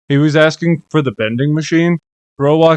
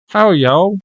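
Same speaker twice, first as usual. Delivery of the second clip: put-on southern accent; 6 semitones higher than usual. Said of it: "a slight southern accent".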